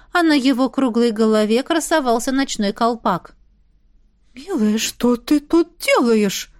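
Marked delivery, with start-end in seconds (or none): 4.3-6.5 slow, puzzled man's voice; bewildered baron. A narrator's reading. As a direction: ask slowly, at a loss. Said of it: "растерянно спросил барон".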